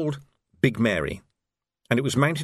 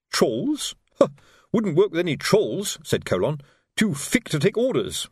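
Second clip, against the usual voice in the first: higher, dismissive sergeant; scoffing Colon; 6 semitones higher than usual. A narrator's reading. Fred Colon scoffing at trolls to Vimes, higher. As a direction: sneer at the trolls.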